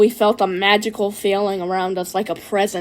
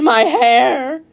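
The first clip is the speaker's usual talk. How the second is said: higher, louder cry of pain; pained scream; 4 semitones higher, 5 dB louder than usual. A narrator's reading. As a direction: scream it out as if hurt. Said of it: "sounded like he was in pain".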